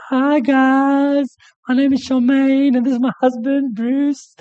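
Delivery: chirpy high voice putting on a show; Charmaine. Retold or imitated. Comedian wife introducing her husband, high and bubbly. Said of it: "and she goes".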